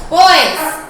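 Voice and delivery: sharp loud call to the dogs. Real, not acted. Stopping the playing dogs mid-story, loud call. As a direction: snap their attention with one loud word.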